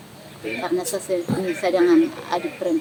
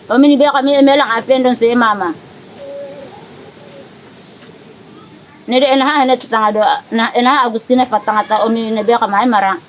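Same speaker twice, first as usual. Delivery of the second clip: raised, higher voice addressing an absent relative; calling message; 5 semitones higher, 6 dB louder than usual. Real, not acted. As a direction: speak up so a faraway loved one hears. Spoken message to Kurtis, local language.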